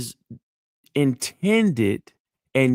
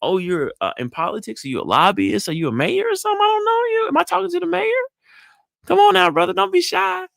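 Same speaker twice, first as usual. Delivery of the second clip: playful flattery. Shown as a demonstration. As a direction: tease him about being the mayor, pitched high. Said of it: "engaging in his passion".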